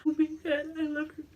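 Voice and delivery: high-pitched imitation of weeping; crying. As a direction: gush through put-on tears. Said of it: "I just started crying".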